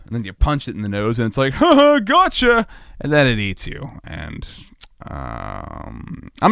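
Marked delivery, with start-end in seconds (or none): 1.5-2.7 high, loud, drawn-out cackling taunt; gloating shark. Acted. Shark tricking the swimmer who punched it, gloating. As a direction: crow it in triumph, slowly.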